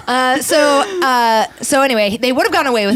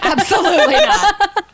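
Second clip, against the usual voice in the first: laughing denial; 8 semitones higher than usual. Real, not acted.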